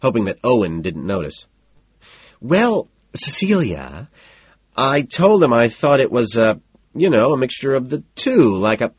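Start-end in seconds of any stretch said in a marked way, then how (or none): 2.4-8.9 high, flustered, stalling explanation; flustered, stretched-out hedging; nervous blabbering. A narrator's reading. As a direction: fumble loudly for a cover story. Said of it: "I immediately start blabbering".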